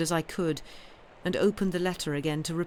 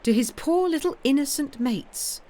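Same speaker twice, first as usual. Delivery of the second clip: higher, mock-pitying sarcasm; sarcastic; 8 semitones higher, 4 dB louder than usual. Governess mocking the school's charge against Miles, sarcastic. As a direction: lay the irony on thick.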